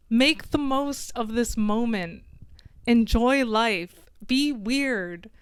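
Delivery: ordinary delivery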